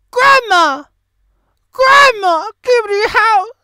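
loud, high call for grandma; whiny loud plea to grandma. Acted. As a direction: shout across the house for her. Calling out to grandma.

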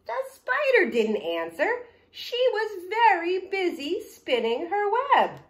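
high, loud refrain for all to join; story reading to children. Acted.

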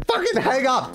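usual speaking voice

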